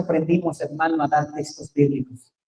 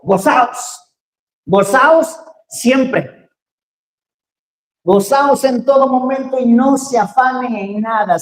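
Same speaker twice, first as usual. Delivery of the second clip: higher, louder scripture-driven preaching; fervent preaching; 5 semitones higher, 4 dB louder than usual. Acted. Preacher exhorting the church to rejoice and cast off anxiety, fervently. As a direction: proclaim it with joy.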